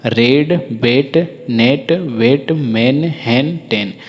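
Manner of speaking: रेड, बेट, नेट very slow, each word separate; read-along drill. Shown as a demonstration. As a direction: lead learners word by word through रेड to टेन with an e vowel. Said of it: "एक बार मेरे साथ आप पढ़िए".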